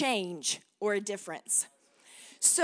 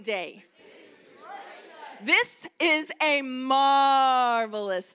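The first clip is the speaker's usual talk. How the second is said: enthusiastic declaration; 3 dB louder than usual. Shown as a demonstration. Preacher leading the congregation in a loud, rousing declaration. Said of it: "can you do it with me this morning".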